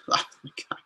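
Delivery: breathless whisper after laughing; whispered disbelief. Real, not acted. Reacting to the Buttgate hashtag, soft whispered oh my God. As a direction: barely voice it, amused disbelief.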